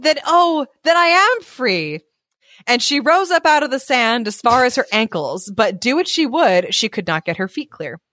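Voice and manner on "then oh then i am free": higher, storybook exclamation of joy; joyful cry. A narrator's reading. Buried girl learning she is free, joyful cry. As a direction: cry out in acted relief. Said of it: "she cried".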